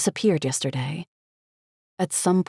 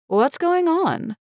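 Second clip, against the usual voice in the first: waitress; 12 semitones higher, slower than usual. A narrator's reading. Waitress arrives confused.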